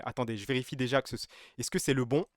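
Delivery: ordinary delivery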